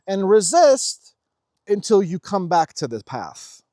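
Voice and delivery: loud, high and drawn-out questioning; exasperated plea. Retold or imitated. He asks how long you will resist the path, exasperated.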